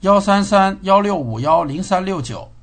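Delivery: loud clear dictation. Real, not acted. Dictating a number to speaker.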